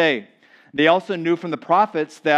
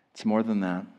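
usual speaking voice

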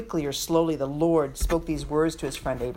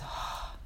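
a long unvoiced sigh of relief; exhale; 12 dB quieter than usual. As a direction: breathe it out before speaking. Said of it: "She exhales and says".

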